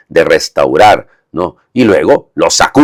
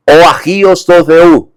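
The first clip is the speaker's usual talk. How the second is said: raised, louder mock demon cry in Greek; 11 semitones higher, 5 dB louder than usual. Priest voicing the demon crying out at Jesus, raised. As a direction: voice the demon naming the Holy One.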